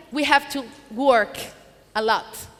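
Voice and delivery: ordinary talk, nothing marked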